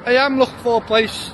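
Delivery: hiccuping between words; talking through hiccups. Real, not acted. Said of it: "Sorry, I got hiccups".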